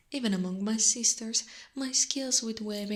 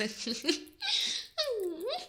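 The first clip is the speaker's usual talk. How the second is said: high, teasing villainess giggle; gloating giggle; 12 semitones higher than usual. Acted. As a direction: giggle high at your helpless prey. Spider gloats over trapped prey.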